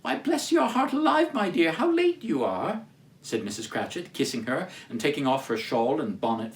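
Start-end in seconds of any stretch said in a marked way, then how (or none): 0.0-2.8 high, fussing motherly voice; fond mother. Acted. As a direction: greet her like a doting mother. Mrs Cratchit greets Martha.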